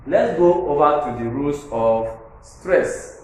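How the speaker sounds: ordinary talk, nothing marked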